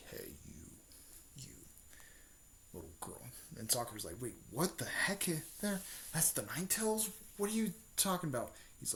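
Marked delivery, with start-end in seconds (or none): 0.1-3.2 low, soft, ghostly whisper; Nine Tails whispering inside Sakura's mind, eerie; whispering demon fox. Retold or imitated. 4.2-8.5 high, hushed and confused; startled whisper. Retold or imitated. Sakura startled by voice in head.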